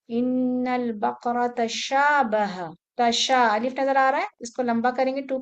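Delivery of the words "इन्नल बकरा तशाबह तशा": drawn-out chanted Quranic recitation; slow recitation. Shown as a demonstration. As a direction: chant it slowly and evenly.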